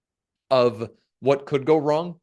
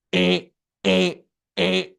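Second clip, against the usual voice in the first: imitated buzzer, short repeated bleats; machine alarm beep; slower than usual. Speaker imitating the scanner across the security queue, alarm beeps. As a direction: mimic an alarm going off.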